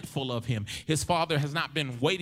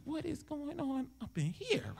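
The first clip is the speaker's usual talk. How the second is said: high, hushed squeal of disbelief; mock bewildered partygoer; 11 semitones higher, 9 dB quieter than usual. Partygoer at a river-dancing club, high hushed disbelief. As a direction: ask it like you can't believe your eyes.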